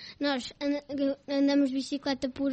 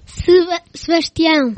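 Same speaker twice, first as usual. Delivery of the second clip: shouted name, much louder; 3 semitones higher, 14 dB louder than usual. Real, not acted. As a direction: shout it clearly this time. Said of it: "Não percebi". (gritado bem alto)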